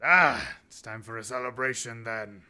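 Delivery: the minotaur chief calling everyone to drink; Minotaur chieftain. Acted. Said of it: "Minotaur chieftain said".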